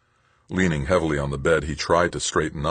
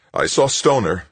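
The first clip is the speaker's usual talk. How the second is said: blurted; 7 semitones higher, 5 dB louder than usual. A narrator's reading. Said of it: "he blurted".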